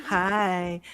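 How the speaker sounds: casual mock-friendly call; nonchalant. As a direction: imitate a nonchalant woman greeting passers-by. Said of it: "this really nonchalant woman being like".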